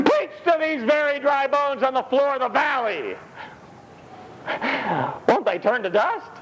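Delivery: preaching shout. Acted. The Lord commands Ezekiel to preach, high calling shout. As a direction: call it out to the whole valley.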